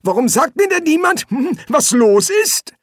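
put-on high, loud, querulous old man; agitated old wizard. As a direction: complain in a piping codger voice. Old Poons demands to know.

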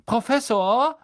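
Professor called out high and loud, rising; calling out. A narrator's reading. Malfoy calls out to the professor across the room, loud and high. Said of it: "rief Malfoy".

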